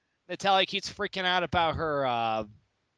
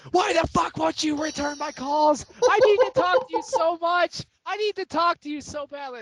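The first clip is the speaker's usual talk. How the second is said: high, loud mock-desperate rant; Natalia's drunk freak-out; 11 semitones higher, 5 dB louder than usual. Drunk wrestler leaving Stephanie an angry voicemail, mock-frantic. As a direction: imitate a frantic drunk caller, pitched way up. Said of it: "the whole drunk tweets thing".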